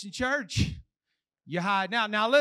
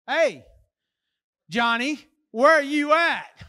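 louder, drawn-out mock demand; parent calling out; 4 semitones higher, 9 dB louder, slower than usual. Parent phones Johnny after curfew, insistent mock demand. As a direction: play a parent checking up by phone.

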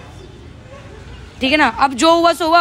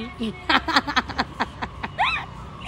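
a long, amused laugh trailing up; 9 dB quieter than usual. Real, not acted. Boyfriend laughing at her complaints, amused laugh.

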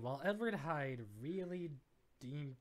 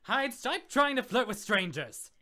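high, loud telling-off; acted scolding; 8 semitones higher, 11 dB louder than usual. Jekyll telling Hyde off for flirting, loud scolding. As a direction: scold sharply, raised and high.

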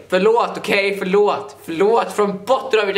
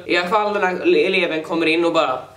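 ordinary delivery

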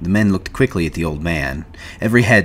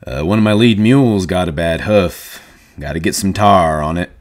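deliberately raised volume; loud; 5 dB louder than usual. A narrator's reading. Slim filling the awkward silence about the dog, loud. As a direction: speak up to the whole room. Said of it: "Slim said loudly".

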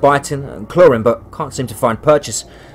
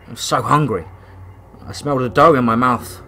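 plain reading voice

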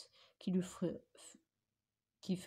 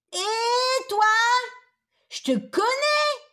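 put-on high, loud child's shout; little girl in red calling out to the wolf; little girl's cry; 12 dB louder, slower than usual. A narrator's reading. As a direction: shriek it like a startled little girl. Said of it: "cria la petite fille".

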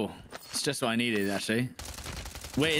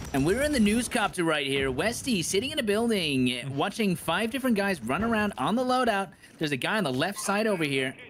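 mock news reporter; 4 semitones higher than usual. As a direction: report it like an on-the-scene newscaster. Mock live news report from the game.

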